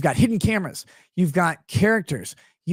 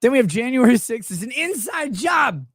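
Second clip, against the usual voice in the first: mock shout; 6 semitones higher than usual. Mocking announcement, shout heard.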